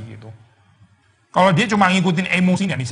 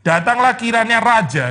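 slow, higher, louder "Datanglah kiranya raja" with weight on raja; stress on raja; 5 semitones higher, 4 dB louder, slower than usual. Shown as a demonstration. Preacher stressing that the king alone is invited, "raja" emphasized. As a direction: lean on "raja". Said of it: "very subtle saya kalimatnya".

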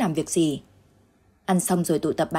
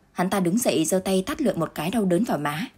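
ordinary delivery, nothing marked

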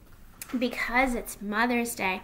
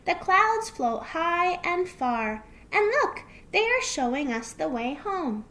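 raised storybook mother voice; lilting, higher-pitched encouragement; gentle wonder; 7 semitones higher, 4 dB louder than usual. Acted. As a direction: point out a marvel to a child. Mother fox shows child clouds.

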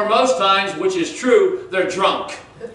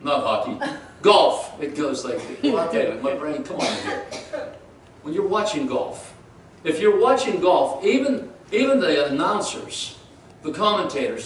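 ordinary delivery

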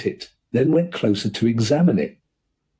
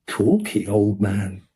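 ordinary delivery, nothing marked

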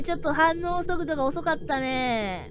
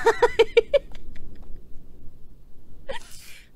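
breathy crying laugh; 12 semitones higher than usual. Real, not acted. Laugh after senpai leaves.

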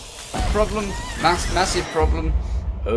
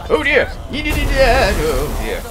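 high, loud startled oh dear; alarmed; 10 semitones higher, 6 dB louder, much slower than usual. Real, not acted. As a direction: yelp it out as danger hits. Player reacting to near hit.

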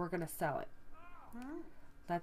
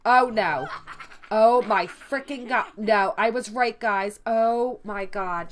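loud, panicked exclamations; real loud dismay at a death; shocked exclaiming; 3 semitones higher, 12 dB louder than usual. Real, not acted. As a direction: react out loud to the disaster on screen. Sim reaper arrives, panic.

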